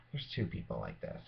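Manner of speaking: usual speaking voice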